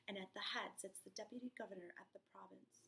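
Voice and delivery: hushed whisper. Acted. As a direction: whisper it like a secret. Whispered reading of the court news.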